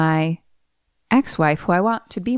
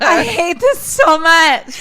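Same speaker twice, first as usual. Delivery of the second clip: higher, louder, slow half-crying whine; mock-despairing wail; anguished whine; 10 semitones higher, 6 dB louder than usual. Real, not acted. Player wailing at the sad twist, half-crying.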